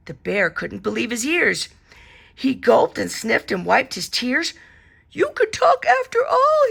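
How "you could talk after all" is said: much higher, louder amazed cry; excited cry. A narrator's reading. The bear finds his teddy talks, amazed. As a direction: burst out in delight. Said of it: "he cried".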